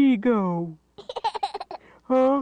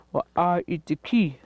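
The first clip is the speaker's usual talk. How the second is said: ordinary talk, nothing marked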